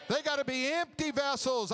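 shouted with the crowd, "empty" punched. Real, not acted. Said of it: "Let's say it louder".

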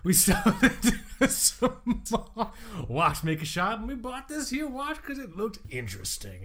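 slow, drawn-out mock old-timer telling a tale; lightly put-on storyteller voice, taking his time; a hint of a folksy old man reminiscing; folksy old collector. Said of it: "why you have this accent now".

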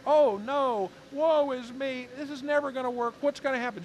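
high, fast hammed-up despair; mock despair. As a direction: moan in mock self-pity. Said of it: "think of this situation like".

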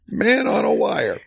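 high, drawn-out mock dad call; hammed-up dad voice, high and slow; imitated dad. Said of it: "my dad".

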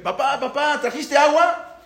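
put-on high-pitched eager child; acted little kid pleading with dad; child calling papá. Hijo recibe al padre con sed. (niño pidiendo, voz aguda)

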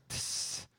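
hiss sound. Pretend. Mock sound.

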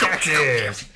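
loud, high cheer; excited shout. Acted. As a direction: belt out the state name. Cheering along after yeehaw.

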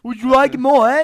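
high, loud put-on Irish lilt; loud cartoonish Irish accent; hammed-up Irish accent. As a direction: belt it in an over-the-top Irish voice. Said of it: "She goes like".

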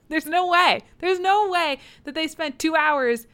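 high, loud disbelief; incredulous protest. Real, not acted. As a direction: protest the plot hole loudly. Host protests a plot hole.

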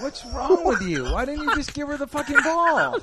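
loud, high, disbelieving scolding; real shocked yell at a friend; incredulous outburst. Real, not acted. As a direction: shout in laughing disbelief.

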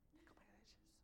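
hushed, breathy echo of the phrase; whisper. Real, not acted. Pastor whispering the phrase back to the congregation. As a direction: drop to a whisper for emphasis.

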